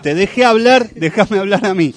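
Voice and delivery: loud, high protest; shouting match. Real, not acted. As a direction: cut in loudly to claim the floor. Discusión entre conductores al aire. (gritando para recuperar la palabra)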